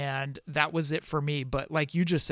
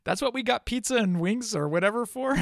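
higher, laughing through the words; amused disbelief; 6 semitones higher, 4 dB louder than usual. Real, not acted. Fan agreeing about the letdown of the cameo, laughing. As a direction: laugh it out in mock complaint.

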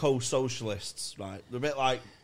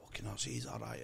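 hushed aside; 13 semitones lower, 9 dB quieter than usual. Retold or imitated. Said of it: "So he's like".

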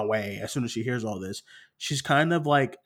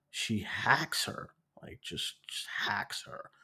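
softer, slow, almost whispered; hushed grim telling; 3 semitones lower, 10 dB quieter, slower than usual. Real, not acted. Telling her friend the murder scene, hushed and slow. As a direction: drop to a breathy whisper for the kill.

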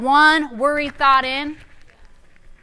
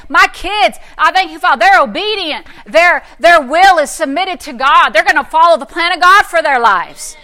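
louder, higher, full-force preaching; preaching declaration; 3 semitones higher, 6 dB louder than usual. Acted. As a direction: declare it to the congregation. Speaking over kids.

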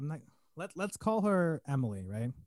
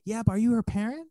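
high, loud mock challenge; challenging mom; 9 semitones higher, 6 dB louder than usual. Mom challenging the helper's right to carry her kid, indignant. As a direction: imitate an indignant mother, pitch up.

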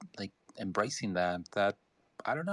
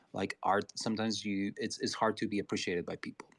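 ordinary talk

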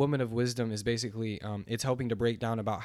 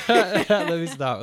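bursting into laughter mid-sentence; high, loud laugh through the words, slowly; 7 semitones higher, 11 dB louder, much slower than usual. Real, not acted. Laughing at himself, trying to stop.

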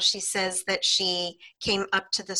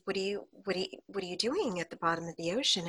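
soft curious question; 8 dB quieter, much faster than usual. Retold or imitated. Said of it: "And I said".

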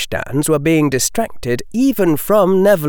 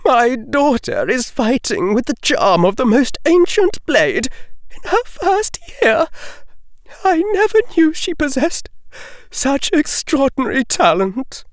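higher, lightly voiced astonished lady; shaken aristocrat; 12 semitones higher than usual. A narrator's reading. Lady Greengrass amazed at her daughter, higher and shaken. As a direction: poise cracking in a noblewoman's voice. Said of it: "the aristocratic poise well punctured".

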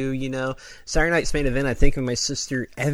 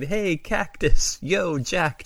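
calling out; 6 semitones higher, much slower than usual. Retold or imitated. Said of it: "and saying".

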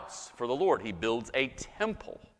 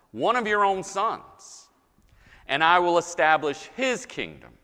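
louder, higher, measured proclamation; emphatic scripture reading; 4 semitones higher, 5 dB louder than usual. Acted. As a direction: proclaim the promise phrase by phrase, with pauses. Reads God's promise aloud from scripture.

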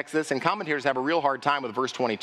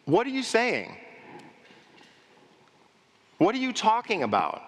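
raised, incredulous challenge; challenging crowd; 6 semitones higher than usual. Retold or imitated. Hometown crowd challenging Jesus, raised and disbelieving. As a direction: demand an answer, disbelieving.

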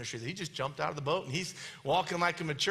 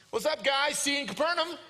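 higher-pitched, casual passing hello; breezy Jesus; 6 semitones higher than usual. Acted. Jesus greeting exhausted disciples as he strolls by, breezy. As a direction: call out a chirpy greeting while strolling by. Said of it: "And he goes".

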